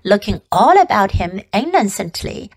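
ordinary delivery

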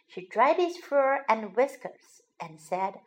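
ordinary delivery, nothing marked

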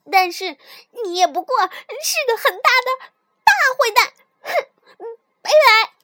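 high, loud furious outburst; angry shouting. A narrator's reading. Mouse breaking off friendship with tiger, angry shouting. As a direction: yell indignantly, ending in a huff. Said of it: "我气得大叫起来".

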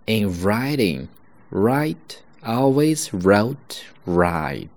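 slow with always wrote right whispered; slow model. Shown as a demonstration. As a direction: drill each r slowly. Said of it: "第三个绕口令". (慢速示范第二个绕口令)

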